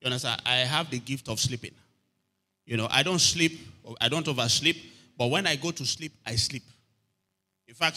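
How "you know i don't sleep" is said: ordinary talk, nothing marked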